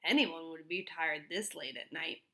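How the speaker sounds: ordinary delivery, nothing marked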